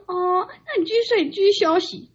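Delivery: grandma telling her to go back to sleep; grandma placating. Retold or imitated. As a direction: soothe in a loud high granny voice. Grandma voiced, backing off.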